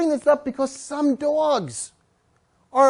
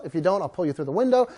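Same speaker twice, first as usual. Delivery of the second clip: normal talking voice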